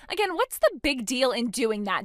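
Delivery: ordinary talk, nothing marked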